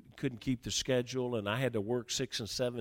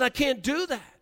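much higher, insistent cry; exasperated shout; 13 semitones higher, 5 dB louder than usual. Retold or imitated. New leader's last refusal to his adviser, shouted high. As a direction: retell the refusal at peak pitch.

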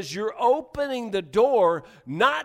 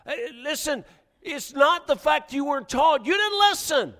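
loud, higher preaching to the congregation; full-force preaching; 5 semitones higher, 3 dB louder than usual. Acted. Preacher pressing his church to listen, full-force preaching. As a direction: bear down on the pews and make them listen.

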